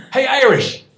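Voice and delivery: host calling to the Irish guest, loud call. Retold or imitated. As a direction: shout a friendly greeting.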